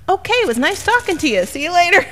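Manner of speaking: high, quick polite brush-off with a laugh; breezily brushing him off to escape; mock brush-off. Wife hurrying off the doorstep, high polite brush-off.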